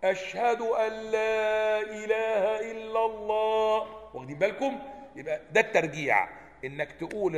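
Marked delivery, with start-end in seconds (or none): 0.0-3.9 higher, slow chanted call; raised voice. Shown as a demonstration. Teacher then raises the testimony as the call does. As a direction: lift your voice and sing it out. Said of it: "ثم ترفع صوتك بالشهادة".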